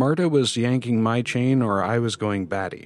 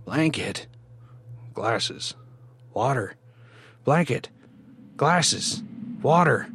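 eerie chorus of patients, rising in urgency; echoing, repeated demands; 5 semitones higher, slower than usual. A narrator's reading. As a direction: start restrained, then climb with each round. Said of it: "repeating their requests over and over".

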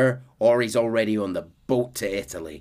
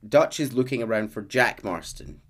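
usual speaking voice

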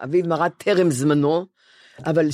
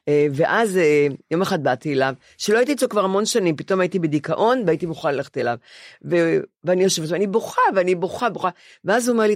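usual speaking voice

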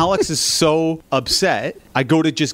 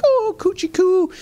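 much higher, mock cooing at the baby; cooing baby talk. Woman cooing over her baby at the changing table, high mock baby talk. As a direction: coo like a doting stranger. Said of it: "She's like".

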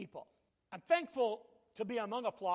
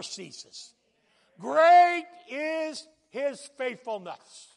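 loud, rising sermon voice, stretched on the big lines; full-force preaching; 3 semitones higher, 11 dB louder than usual. Acted. Preacher proclaiming God's faithfulness to his flock, full-force preaching.

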